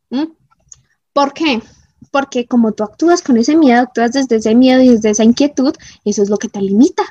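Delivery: ordinary delivery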